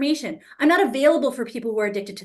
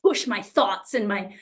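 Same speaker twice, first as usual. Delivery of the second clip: ordinary talk, nothing marked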